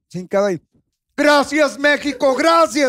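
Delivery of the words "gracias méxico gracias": gritito agudo y fuerte de ganador; hincado, agradeciendo al público a gritos; imitando a Albacete. Retold or imitated. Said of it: "salía y gritaba". (imitando el grito de Albacete, agudo y fuerte)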